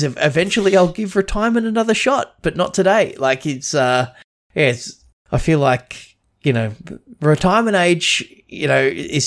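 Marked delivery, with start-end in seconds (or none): 0.0-3.1 lightly voiced weary old detective, pitched up; quoting flavor text higher. Reading the card's flavor text to friends, a lightly voiced tired old cop. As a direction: read the card line like a tired veteran cop. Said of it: "his flavor text says".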